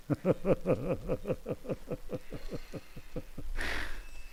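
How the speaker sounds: real airy laugh. Real, not acted. Surgeon laughs at his own joke about anesthesia, airy.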